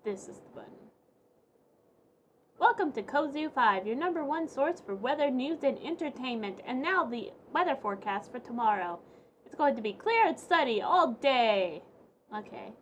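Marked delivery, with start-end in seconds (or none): 2.6-11.8 bright, upbeat newscaster read; TV announcer. Acted. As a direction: announce it like the TV news. Reads TV announcer lines.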